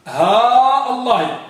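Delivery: slow model with drawn-out "ها" before "الله"; long vowel and hamza. Shown as a demonstration. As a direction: stretch "ها" long, then "الله" with a glottal stop. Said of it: "بالمد". («ها» بالمدّ و«الله» بالقطع)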